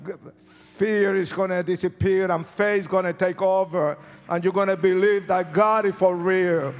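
loud, soaring altar-call preaching over music; full-force altar call. Acted. Pastor urging the congregation to come forward, preaching at full force. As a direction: drive them forward out of their seats.

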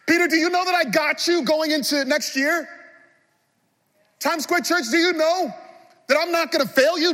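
high, loud, building preacher voice as Jesus; full-force preaching. Acted. Jesus pressing Peter and the church, full-force preaching.

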